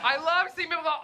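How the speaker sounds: ordinary talk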